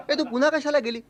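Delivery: high-pitched butting-in question; incredulous heckler. Acted. Neighbour interrupting the boastful storyteller, incredulous.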